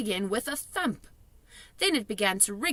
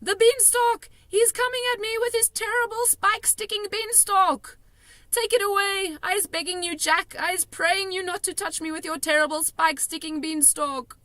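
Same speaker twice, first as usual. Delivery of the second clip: high, loud frantic pleading; desperate begging; 11 semitones higher, 5 dB louder than usual. A narrator's reading. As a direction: plead for mercy in panic. Giant begging in nightmare.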